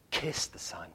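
breathy hushed plea; soft urgent whisper. Acted. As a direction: whisper it like a secret. Whispered scripture line.

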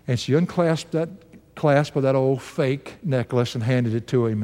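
ordinary talk, nothing marked

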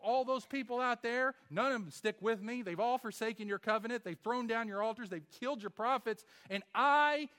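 mock Elijah's self-pitying whine; high mocking whine, Elijah feeling sorry for himself; whiny self-pity. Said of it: "He's like".

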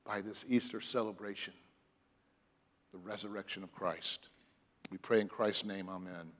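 soft, low, reverent prayer voice; soft, reverent closing prayer; hushed prayer. Acted. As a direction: pray quietly to the Father.

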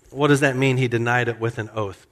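ordinary talk, nothing marked

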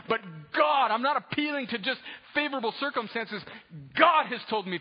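loud, high-pitched, impassioned preacher; full-force preaching. Acted. Preacher retelling Nehemiah's backing from the king, full-force preaching. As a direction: build the case at full volume.

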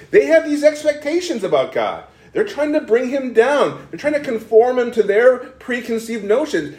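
high-pitched, insistent preacher; impassioned preaching. Real, not acted. Preacher explaining why Israel cannot accept Yahweh, impassioned. Heightened preaching about false expectations.

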